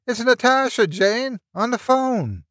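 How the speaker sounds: high-pitched call to someone down the hall; yelling down hallway. A narrator's reading. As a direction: call out excitedly, voice pitched right up. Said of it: "He yelled down the hallway to Jane".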